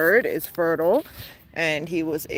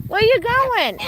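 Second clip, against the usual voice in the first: high sing-song pet call; calling the bird; slower than usual. Real, not acted. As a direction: coax the bird over in a high voice. Talking to the bird.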